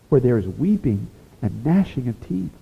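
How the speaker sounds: ordinary talk, nothing marked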